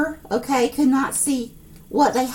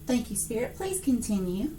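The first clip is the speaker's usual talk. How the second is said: hushed aside to Spirit; 3 semitones lower, 10 dB quieter than usual. Real, not acted. Quietly addressing spirit during reading.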